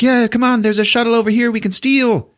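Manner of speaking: high, bright-eyed urging; lightly voiced eager Rose; eager girl voice. Rose urges Finn toward a shuttle, lightly voiced eager call. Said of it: "she's like".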